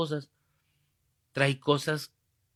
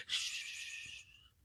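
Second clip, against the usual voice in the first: long soft shush; urgent shushing; 17 dB quieter than usual. Retold or imitated. Man silencing the priest who blurted his secret, shushing.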